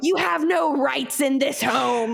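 slow mocking decree; mock stern brothers. Brothers mocking her teen privacy, slow stern decree.

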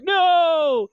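long, high, loud wail; mock movie scream. Retelling Luke's reaction to the father reveal, mock scream. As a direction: cry out in drawn-out mock anguish. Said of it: "and he's like".

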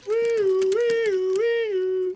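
siren imitation.

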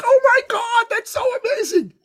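high, gushing excited praise; delighted gush. Retold or imitated. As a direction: rave back at her good news. Host reacts to Julie's news.

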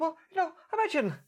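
put-on high, abandoned stab at an Irish priest accent; attempted Irish accent. Host quoting Father Ted in a half-tried Irish accent. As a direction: start Father Ted's Irish lilt, then give up. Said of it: "I can't do Father Ted's Irish accent".

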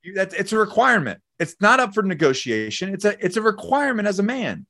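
high, insistent, fired-up urging; impassioned exhortation. Real, not acted. Telling men fitness is required of them, impassioned exhortation. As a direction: drive it home like a non-negotiable rule.

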